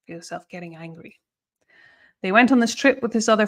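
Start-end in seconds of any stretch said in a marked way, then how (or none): 0.1-1.2 hushed and intense. Acted. Teacher urging students to feel the anger, hushed. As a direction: say it low, drawing them in.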